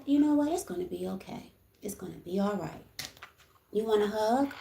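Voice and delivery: soft, kind reassurance; softly offering a hug; gentle reply to a bully. Shown as a demonstration. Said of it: "just be like".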